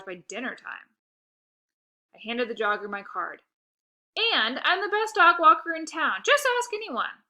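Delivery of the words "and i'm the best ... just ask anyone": much higher, louder bragging; boastful pitch. Acted. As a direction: brag to land the client. Boasting to jogger.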